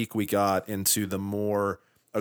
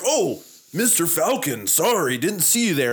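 suddenly polite cops; 6 semitones higher than usual. Retold or imitated. Imitating cops changing demeanor for Falcon, mock polite and higher. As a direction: fawn over him in a lightly voiced higher pitch. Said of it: "And then they're like".

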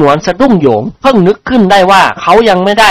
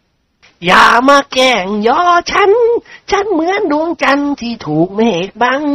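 slow, soft, high bashful protest; coy, embarrassed lord fishing modestly; bashful smile; 10 semitones higher, 3 dB quieter, slower than usual. A narrator's reading. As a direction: draw it out shyly through a smile. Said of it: "เจ้าคุณประสิทธิ์ยิ้มอายๆ".

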